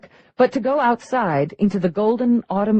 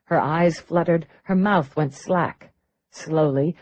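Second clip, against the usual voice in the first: ordinary delivery, nothing marked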